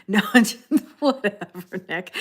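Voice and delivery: words breaking up into laughter; laughing. Real, not acted. Streamer waving off a viewer's hickey joke, laughing. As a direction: dismiss it while cracking up. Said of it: "I'm still laughing at you".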